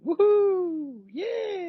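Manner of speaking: high, whooping cheer. Real, not acted. Host cheering the game name for the audience, high whoops. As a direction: whoop with glee at the news.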